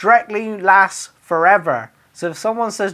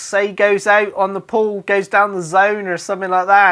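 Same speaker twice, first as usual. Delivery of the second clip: normal talking voice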